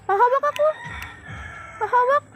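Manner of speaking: high sing-song coaxing voice; high cooing to coax the pet closer. Real, not acted. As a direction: coo at the pet to let you touch it.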